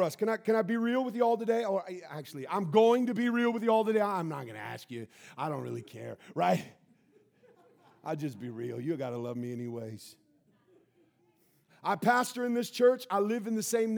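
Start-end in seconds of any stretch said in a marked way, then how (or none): 4.0-10.2 candid aside. Real, not acted.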